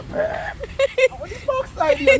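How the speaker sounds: high, very slow, drawn-out cry of disgust; mock outraged squeal. A man on the bus, shocked by the smell, squealing. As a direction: stretch it out high like a shocked passenger. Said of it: "And so".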